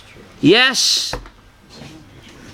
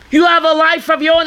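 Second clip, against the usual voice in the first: angry shout; 6 semitones higher, faster than usual. Retold or imitated. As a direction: shout it at her to snap her out of it. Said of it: "he reacted so angrily".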